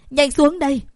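panicked plea. Acted. Daughter pleading with mother at the window, loud and panicked. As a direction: beg her urgently to get down.

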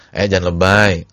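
loud scornful telling-off; dismissive rebuke. Retold or imitated. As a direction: snap it at him dismissively.

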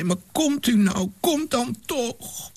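put-on high, pleading girl's voice; desperate plea. A narrator's reading. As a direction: beg him to come, in despair. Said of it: "met zo'n wanhoop in haar stem". (wanhopig smekend, hoge stem)

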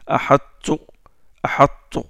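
whispered ahattu, the t half-held; demonstrated ahattu. Shown as a demonstration. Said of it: "teacher demonstrates ahattu".

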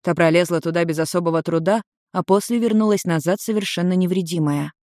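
plain reading voice

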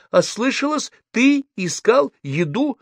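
very high, slow incredulous question; mother aghast, pitch way up. A narrator's reading. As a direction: draw it out in high disbelief.

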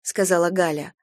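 plain reading voice

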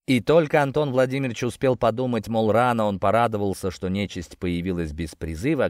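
plain reading voice

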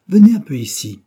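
a chief shouting for his men to come; calling out. Acted. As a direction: bellow them over to you.

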